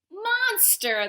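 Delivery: high gleeful cheering. Acted. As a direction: cheer it as a gang. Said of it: "they all cheered together".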